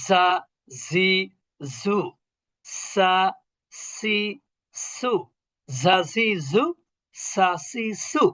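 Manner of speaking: জা জি জু buzzed as z, সা সি সু hissed as s; buzzing, hissing sibilants. Shown as a demonstration. Teacher drilling sibilants for pupils, buzz then hiss. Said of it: "ঝিঝি পোকা যেমন শব্দ করে".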